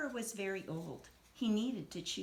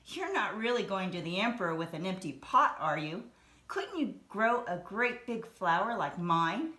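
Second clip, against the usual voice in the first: teasing boast; 7 dB louder than usual. Acted. Friend teases Ping over pot.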